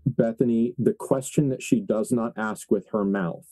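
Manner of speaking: ordinary talk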